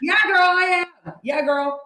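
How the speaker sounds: loud, high answer with a laugh; retold happy holler; hollering back. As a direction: call it back across the room, imitating the greeting. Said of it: "I was like".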